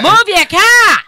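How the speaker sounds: high, loud Boston yell; shouted demand. Acted. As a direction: holler it out the door, Boston-style. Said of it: "open the door and say".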